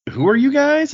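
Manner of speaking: suddenly high and loud, demanding who they are; high shouted question. Retold or imitated. Quoting a cartoon scene, a high loud demand. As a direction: bark it out high and sharp. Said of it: "And so it's like".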